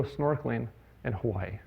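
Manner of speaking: holding back tears over his mom's cancer; choked up. Real, not acted. Son telling his mother's cancer story, choking up quietly. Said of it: "Sorry, I don't get emotional".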